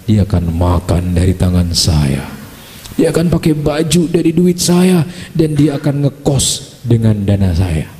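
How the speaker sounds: lightly voiced earnest groom proposing; gallant suitor. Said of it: "Padahal ketika meminang istrinya tuh gagah sekali". (pelamar gagah, suara rendah dan pelan)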